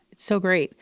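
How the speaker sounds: ordinary talk, nothing marked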